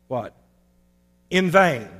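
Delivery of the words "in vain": "In vain" punched loud and falling; stress on in vain. Shown as a demonstration. Preacher drives home to the congregation: "In vain" stressed loud. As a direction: lean hard on "In vain". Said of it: "The emphasis on "in vain".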